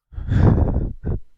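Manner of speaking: loud, breathy real laugh; burst of laughter. Real, not acted. Streamer reacting to a riddle from chat, loud laugh.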